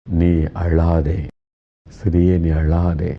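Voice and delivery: slow, tender and soothing; comforting voice. Acted. Jesus consoling a grieving widow, slow and tender. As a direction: gently hush a weeping woman, very slowly. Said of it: "ஆறுதலான வார்த்தை சொல்லுகிறார்".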